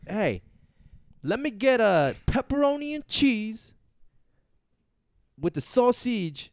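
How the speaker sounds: loud, high, slow mock Italian New Yorker; forced-out accent. Shown as a demonstration. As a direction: force out each word in a big Italian-American accent. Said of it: "force out the words".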